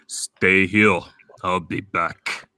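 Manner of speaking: put-on low, slow Austrian-accented delivery; slow Austrian accent, heavy and low; authentic Arnold Schwarzenegger. Showing an authentic Arnold, slow low Austrian accent. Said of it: "an actual voice".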